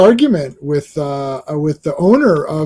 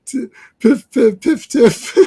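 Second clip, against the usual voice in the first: high, quick mouth-made pew noises; blaster sound effects; 6 semitones higher, slower than usual. Acted. Imitating the comic's gunfire, playful pew-pew noises. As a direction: spit out the zaps like a kid playing.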